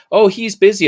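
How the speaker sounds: put-on high, breezy brush-off voice of a young receptionist. Young receptionist brushing off a determined student, high and breezy. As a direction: chirp it out like a teen receptionist waving him off.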